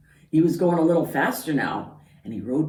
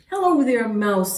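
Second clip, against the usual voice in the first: high, louder duck greeting; cheery Duck; 6 semitones higher, 5 dB louder than usual. Acted. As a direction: sing out hello as Duck. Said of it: "said Duck".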